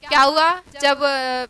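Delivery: ordinary talk, nothing marked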